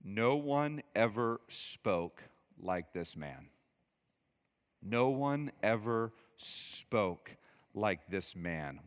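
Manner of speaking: slow, spaced-out words of wonder; hushed-pace awe, drawn out on "spoke"; awed reply. Acted. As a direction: let each word land slowly, as if gripped. Voices the guards' awed answer.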